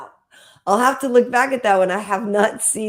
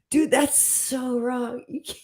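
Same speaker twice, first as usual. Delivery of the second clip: normal talking voice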